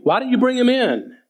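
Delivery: mock the leaders' irritation; upset Pharisees. Religious leaders scold officers for not arresting, impatient. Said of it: "they were upset".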